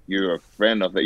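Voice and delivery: low, soft ominous line; menacing gangster. Shown as a demonstration. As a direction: show how the threat is done. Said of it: "You have to do the face".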